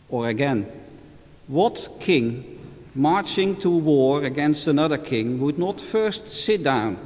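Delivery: measured solemn scripture reading; Gospel reading. Acted. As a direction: read it like a priest at Mass. Formal Gospel reading at Mass.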